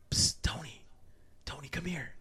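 soft unvoiced hiss of a name; whispered call. Little brother calling from bed at night, a soft whisper. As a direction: whisper it so nobody else wakes.

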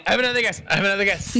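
blurting out another guess, keen; eager. Real, not acted.

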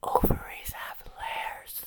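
ASMR whisper into the mic; sweet nothings. Acted. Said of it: "We are going to whisper sweet nothings".

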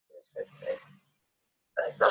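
usual speaking voice

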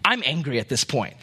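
ordinary talk, nothing marked

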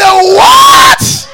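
very high, very loud drawn-out yell; full-voiced re-enacted cry of amazement; astonished shout. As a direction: shriek it in disbelief. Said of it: "I shouted".

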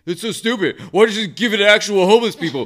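high, loud mock of a whiny online critic; mocking commenters. Mocking the internet critics of his giveaway, high whiny sneer. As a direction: sneer it like a petty internet troll. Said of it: "people were like".

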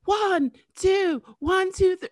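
high-pitched imitation of a band's count-in; mimicked concert count-off; singer counting in. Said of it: "and it'd be like".

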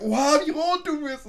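loud, high mock astonishment; mocking classmate. Classmate exposing someone's blush, loud mock shock. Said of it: "Er sagt".

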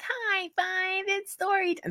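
imitating a child; high mock kid chant. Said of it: "that's my kids' voices when they were, like, six and seven".

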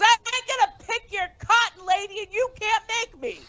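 playful high-pitched refusal in a packed Walmart; mock defiant boyfriend. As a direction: throw it back at her in a hammed-up high voice. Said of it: "He goes".

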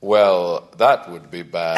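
slow, low sheriff drawl; lightly voiced western sheriff. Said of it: "I've also started speaking like a sheriff".

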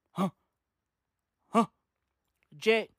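ordinary talk, nothing marked

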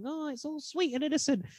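high sweet naive voice; sweet innocent villager. Retold or imitated. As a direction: play it dainty and innocent. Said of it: "this lovely village who's like".

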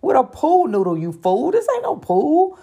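building from flat dread to a high mock-panicked squeal; hammed-up alarm climbing to a squeak; retold panic. To the guide about the pool noodle, mock panic rising high. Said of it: "So I'm already in panic mode".

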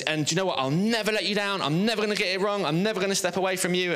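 high-pitched, insistent pledge; boastful promise. Acted. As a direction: vow it eagerly, each promise held up high. Peter boasting his loyalty to Jesus.

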